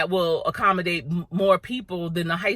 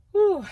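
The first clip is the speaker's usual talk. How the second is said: high breathy whew of relief; relieved whew; 4 dB louder than usual. Real, not acted. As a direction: blow out a big whew. Exhale after heated rant.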